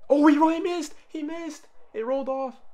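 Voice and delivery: very high, loud disbelief; excited shouting. Real, not acted. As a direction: yell in thrilled surprise. Live reaction to the missed free throw.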